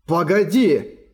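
urgent call to wait; shout. A narrator's reading. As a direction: cry out to stop him. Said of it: "крикнул Эрагон". (громкий крик)

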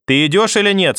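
loud impatient yell; shouting. Acted. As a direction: bark it from the kitchen. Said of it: "снова крикнула бабушка". (громкий нетерпеливый крик)

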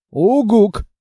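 loud falling hoot; firm hoot. Acted. Monster hoots firm agreement to the girl.

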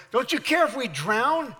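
acted frustration. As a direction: plead like a frustrated disciple. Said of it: "when we're all frustrated like the disciples".

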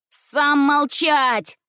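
high, drawn-out angry cry; defiant retort. A narrator's reading. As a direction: shout back at big brother, high. Little brother shouts back. (задиристо, высоким криком)

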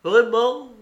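high, hopeful question; almost joyful. A narrator's reading. Dick learns the Bible may still serve, almost joyful. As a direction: perk up with relieved delight. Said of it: "presque joyeux".

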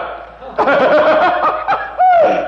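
high hearty burst of laughter; real amused laugh; loud laugh. Real, not acted. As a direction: laugh hard at the punchline. Laughs at the story.

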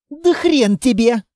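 spat back with some force; defiant refusal. A narrator's reading. Litvin answers the order to drop guns, defiant.

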